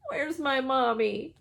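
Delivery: plain reading voice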